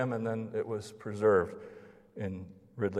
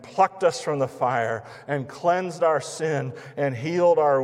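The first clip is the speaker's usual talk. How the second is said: fervent preaching; 4 semitones higher, 5 dB louder than usual. Real, not acted. Rising preaching.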